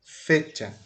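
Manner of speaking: "Fecha" with a punched "Fe"; stressed first syllable. Shown as a demonstration. Teacher showing where the force goes, "Fecha" stressed on "Fe". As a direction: hit "Fe", let "cha" drop in "Fecha". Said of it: "botar força mais no fe".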